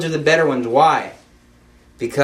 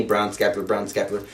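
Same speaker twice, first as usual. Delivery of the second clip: inner urging, softer; 6 semitones lower, 7 dB quieter than usual. Retold or imitated. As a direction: lower and softer, like a nudge inside. His heart's inner prompting.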